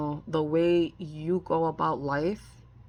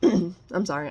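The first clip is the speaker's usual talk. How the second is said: ordinary talk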